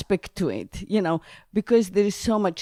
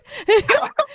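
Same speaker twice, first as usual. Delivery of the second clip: a squeal of high laughter; 4 dB louder than usual. Real, not acted. Laughing with the host about the family. Laughs after I know right.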